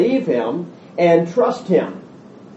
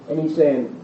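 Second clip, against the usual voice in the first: ordinary talk, nothing marked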